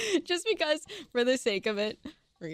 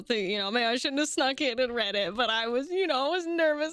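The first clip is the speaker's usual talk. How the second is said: ordinary talk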